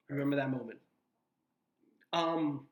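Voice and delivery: talking through hiccups; holding back a hiccup; suppressing hiccups. Real, not acted. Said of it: "I'm sorry, I have the hiccups".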